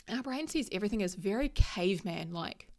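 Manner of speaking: usual speaking voice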